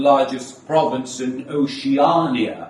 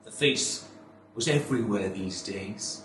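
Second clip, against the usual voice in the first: soft, hushed dread; 6 semitones lower, 9 dB quieter than usual. Acted. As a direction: drop to a near whisper. Hushed stage line.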